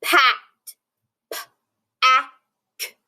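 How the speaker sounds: P-A-K-T sounded out slowly letter by letter; sounding out a syllable. Shown as a demonstration. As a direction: spell it out sound by sound. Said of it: "let's sound out the second syllable".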